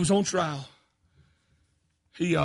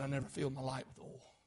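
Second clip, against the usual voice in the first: low, very soft admission; hushed confession; 7 semitones lower, 15 dB quieter than usual. Acted. Watchman admitting he had no oil, hushed confession. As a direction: drop to a near-hush to admit it.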